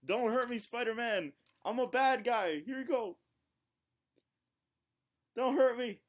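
high-pitched, playful plea for mercy; mock pleading. Pretend bad guy begging Spider-Man for mercy, mock pleading. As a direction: beg like a hammed-up cornered villain.

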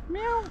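high, soft imitation meow to a cat; mock meow. Walker calling a stray cat with a high mock meow. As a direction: coax the kitty with a high mew.

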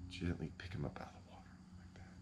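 hushed whisper, quick. Shown as a demonstration. Angler miming lifting fish from the water, whispering. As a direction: whisper it like not to scare the fish. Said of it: "I just gently".

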